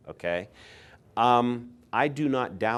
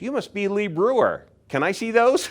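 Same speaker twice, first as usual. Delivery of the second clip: much higher, louder, eager greeting; eager stranger; 13 semitones higher, 7 dB louder than usual. Retold or imitated. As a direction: gush like a fan spotting a celebrity. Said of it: "at me and goes".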